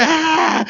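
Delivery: high-pitched imitated wail; mock child crying. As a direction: fake a child's bawling. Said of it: "está llore y llore". (llanto de niño fingido)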